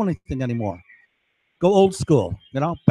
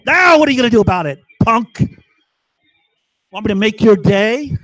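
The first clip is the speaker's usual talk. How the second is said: louder, higher and fast challenge, punk; tough-guy taunt; 4 semitones higher, 5 dB louder than usual. Real, not acted. Challenging the eavesdropping neighbor, loud fast taunt. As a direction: dare him to try something.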